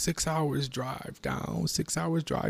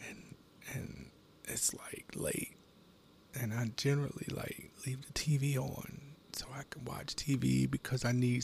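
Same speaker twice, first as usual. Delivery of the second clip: hushed whisper; 6 dB quieter than usual. Real, not acted. Man whispering about the silence at night. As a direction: whisper like it's late at night.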